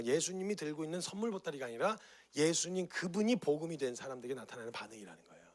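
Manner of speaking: usual speaking voice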